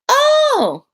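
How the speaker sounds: high loud gasp of surprise; a sudden surprised oh. Real, not acted. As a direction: caught off guard by a comment. Reacting to chat comment.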